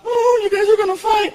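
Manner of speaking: put-on a touch of a worried kid. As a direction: say it like a knowing little boy. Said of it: "Frankie walks up".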